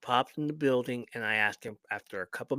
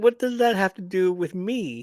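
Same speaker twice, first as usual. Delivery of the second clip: indignant retort; 7 semitones higher, 7 dB louder than usual. Retold or imitated. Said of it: "I said".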